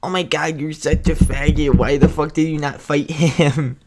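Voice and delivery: lightly voiced mocking troll; a hint of jeering commenter; imagined commenter. Imagined viewer mocking him in the comments, lightly voiced jeer. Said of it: "I can just hear".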